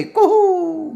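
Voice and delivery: long falsetto cuckoo hoot; cuckoo call. A narrator's reading. Doctor proving his point, falsetto cuckoo call. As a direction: hoot it very high.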